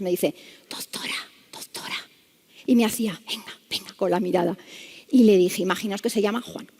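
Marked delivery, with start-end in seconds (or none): 0.7-2.0 imitated patient's whisper, barely voiced. Bedbound patient calls the doctor over, whispering. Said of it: "sí que hablaba en susurros". 3.3-3.9 hushed, voiceless beckoning; whisper. Retold or imitated. Patient beckons the doctor with his eyes, whispering. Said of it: "sí que hablaba en susurros".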